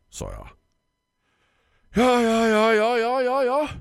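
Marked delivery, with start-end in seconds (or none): 1.9-3.7 high, loud impatient yes yes yes; exclaiming florist. Acted. Florist bursts out impatiently at the customer, high and loud. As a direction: burst out in a rapid stream. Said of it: "utbrast Tisten".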